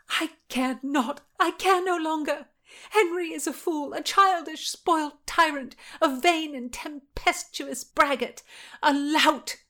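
high, scornful outburst; defiant Anne. Acted. Anne rails against King Henry, defiant.

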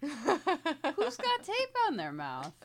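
put-on high, slow coaxing voice to a small child; talking to a toddler. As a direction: gently coax a little kid. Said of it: "on my knees and be like".

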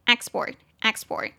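"export" stressed on EX; stress on first syllable. Shown as a demonstration. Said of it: "重音节在第一音节".

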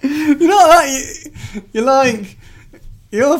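words squeezed through laughter; laughing. Real, not acted. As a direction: talk while laughing hard. Laughing at the accent.

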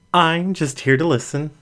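ordinary delivery

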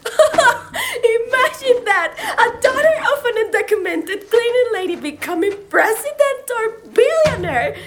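laughing through the words. Real, not acted. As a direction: laugh in disbelief at the dream. Laughing at own big dream.

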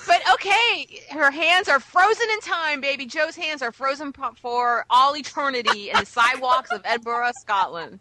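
loud, excited big reveal. Real, not acted. Excited big news announcement.

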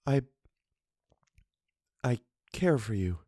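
soft, hushed confession; a faint, trembling murmur; murmured, almost inaudible. A narrator's reading. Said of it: "she murmured in a voice almost inaudible".

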